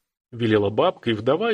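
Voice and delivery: ordinary delivery, nothing marked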